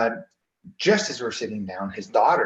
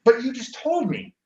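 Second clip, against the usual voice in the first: high-pitched mock disbelief; retold incredulous protest; 11 semitones higher than usual. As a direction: protest in a much higher voice, baffled. Retold baffled protest at dad.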